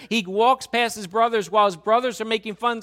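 fervent sermon delivery; full-force preaching. Acted. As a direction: press the congregation with rising intensity.

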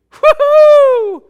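high, loud mock whoop; hammed-up party whoop; mock cheer. Mock celebration of being off hook.

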